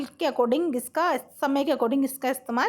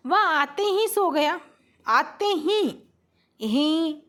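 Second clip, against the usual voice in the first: आते ही drawn out slow and higher, leaning on ही; stress on ही; 4 semitones higher, much slower than usual. Shown as a demonstration. Teacher showing students the emphatic particle, stress on ही. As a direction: stretch and press the particle ही in आते ही. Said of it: "और जब जोर देके".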